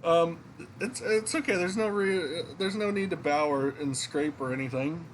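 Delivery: flustered game character. Acted. Entertainer's daughter waves off bowing, high flustered character voice. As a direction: raise the pitch, stumble modestly.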